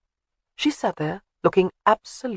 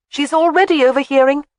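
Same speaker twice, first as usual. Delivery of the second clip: much higher, loud playful call; 10 semitones higher, 7 dB louder than usual. A narrator's reading. Wife calling from behind the screen, high and loud. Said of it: "Jamie called out from behind the screen".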